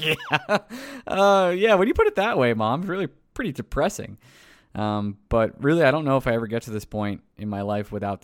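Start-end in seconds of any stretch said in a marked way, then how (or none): 0.0-4.2 laughing reply. Retold or imitated. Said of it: "It's like".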